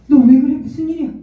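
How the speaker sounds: put-on startled mom rushing to her crying child; alarmed mother.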